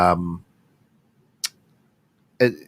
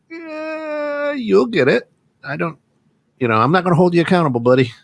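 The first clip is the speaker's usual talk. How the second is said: reluctant, easygoing buddy; 10 semitones higher than usual. Retold or imitated. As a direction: imitate pals tiptoeing round each other, pitched up. Mimicking overly friendly partners avoiding accountability.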